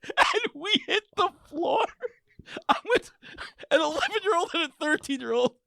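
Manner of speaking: high-pitched, laughing so hard it squeaks; cracking up. Real, not acted. Telling how they hit the floor, cracking up. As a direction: tell it while helpless with laughter.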